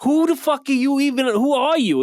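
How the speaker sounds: high, mock indignant girl; hammed-up offended girl voice; snooty girl. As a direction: squeal like an offended model. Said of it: "then they're like".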